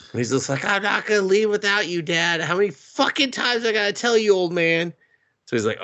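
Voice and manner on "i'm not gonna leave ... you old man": high-pitched, exasperated retold protest; mock exasperation. Son refusing to leave his dad, high mock exasperation. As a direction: imitate a fed-up son.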